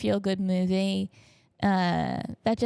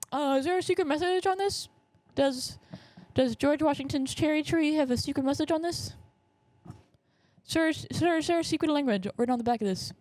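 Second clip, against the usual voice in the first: put-on high-pitched pestering kid questions; eager kid; 7 semitones higher than usual. Kid quizzing museum staff about secret messages, eager and high. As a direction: pipe up like an over-keen child. Voices younger self at museum.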